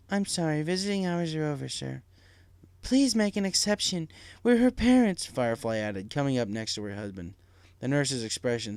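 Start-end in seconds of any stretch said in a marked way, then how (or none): 0.1-2.0 lightly raised, kindly nurse. Acted. Desk nurse voiced. 2.8-5.3 loud, high, urgent plea; mother pleading with the nurse, loud and urgent; desperate plea. Acted. Parents desperate to see daughter.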